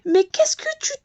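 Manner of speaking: high, frightened squeal; panicked. A narrator's reading. The little bee pushed into the water, panicked high cry. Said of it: "la petite abeille affolée".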